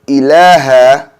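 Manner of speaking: ordinary talk, nothing marked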